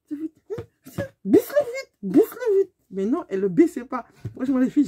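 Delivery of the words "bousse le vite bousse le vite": high, very slow, laughing demand; mocking squeal. Ordering the other driver to roll the window down, high mock squeal. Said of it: "J'ai fait".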